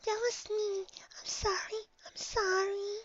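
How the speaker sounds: tiny high, soft voice; timid apology. A narrator's reading. Shy pony apologising to her friend, meek. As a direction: repeat it softly and meekly.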